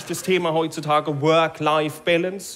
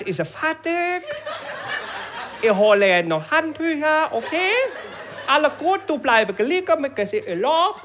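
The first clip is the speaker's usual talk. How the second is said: put-on higher, lightly accented broken German with questioning rises; Thai masseuse; 7 semitones higher than usual. Thai masseuse reassures the client, higher with rising okays. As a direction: fuss over the client in a sing-song foreign lilt.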